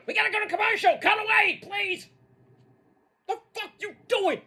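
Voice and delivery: high-pitched outraged shouting; exasperated rant. Acted. Host berates the producer for cutting away from a brawl, exasperated high rant. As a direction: rail at them in high, incredulous outrage. Ranting at show cutting to commercial.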